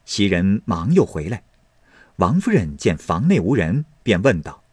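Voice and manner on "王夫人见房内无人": usual speaking voice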